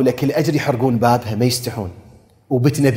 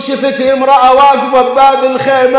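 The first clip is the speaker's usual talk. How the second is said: high, loud mourning cry to the crowd; full-force lament preaching; 11 semitones higher, 6 dB louder than usual. Acted. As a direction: preach the tragedy at the top of your voice. (خطابة حسينية بأعلى صوت)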